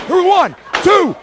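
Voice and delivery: counting the pin high and loud; excited pin count. Acted. Wrestler commentating his own pin, excited count. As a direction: count it up like the ref's hand is falling.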